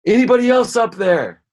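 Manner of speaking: high, loud holler upward; desperate scream. Acted. Man on the branch rejects God's advice and calls up, desperate scream. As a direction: yell past God for any other rescuer. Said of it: "he looks up and he screams".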